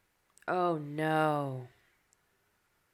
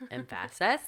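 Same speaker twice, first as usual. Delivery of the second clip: "Emphasis" stressed on the middle syllable, em-FASS-is; joking em-FASS-is for "Emphasis", rising at the end; deliberately misstress "Emphasis" on its second syllable; misplaced stress; 6 semitones lower than usual. Pretend. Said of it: "Emphasis on the wrong syllable".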